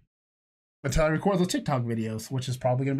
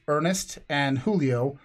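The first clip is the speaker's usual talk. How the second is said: ordinary talk, nothing marked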